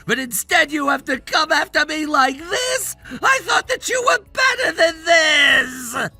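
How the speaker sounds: tearful, indignant shouting; offended tantrum. Acted. Spoiled son answering being called pathetic, offended tantrum. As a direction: whine and yell that you've been wronged.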